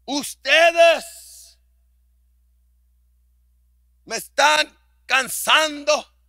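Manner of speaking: put-on a fed-up dad telling off his girls; exasperated father scolding. Pastor voicing himself scolding his daughters, exasperated.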